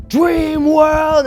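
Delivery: full-throated high scream of the code words; shouted rally cry before dropping in. Retold or imitated. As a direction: scream it out at the top of the drop. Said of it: "ended up screaming it".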